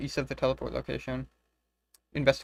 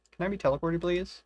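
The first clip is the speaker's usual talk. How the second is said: ordinary delivery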